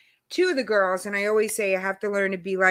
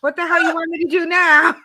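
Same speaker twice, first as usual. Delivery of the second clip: higher, louder, fed-up snap; exasperated outburst; 5 semitones higher, 7 dB louder than usual. Retold or imitated. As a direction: blurt it out, at the end of your rope. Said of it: "I'm like".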